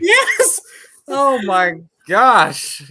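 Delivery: loud high laughing "Yes!"; laughing excitement. Real, not acted. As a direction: shout it, laughing hard. Excited agreement while laughing.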